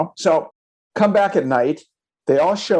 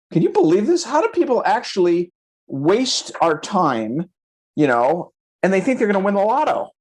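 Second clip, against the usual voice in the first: indignant disbelief; 6 semitones higher than usual. Retold or imitated. Agent venting to the owners about time-wasters, indignant disbelief. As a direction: complain in amazed, high-pitched exasperation.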